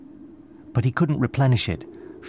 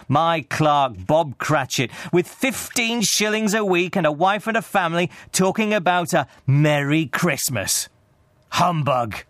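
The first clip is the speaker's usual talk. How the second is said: higher-pitched, scornful Scrooge; grumbling miser; 8 semitones higher than usual. A narrator's reading. Scrooge scoffs at his underpaid clerk, indignant.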